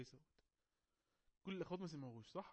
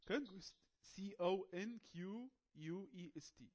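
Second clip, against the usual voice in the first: slow, higher, letter-by-letter dictation; spelling out; 5 semitones higher, much slower than usual. Shown as a demonstration. Teacher spells conquest for the students, slow dictation. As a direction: spell it out slowly, drawing out each letter.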